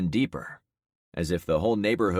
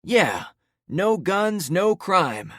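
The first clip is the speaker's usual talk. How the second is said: loud agreement; 12 semitones higher, 6 dB louder, much slower than usual. Acted. Crowd member agrees loudly.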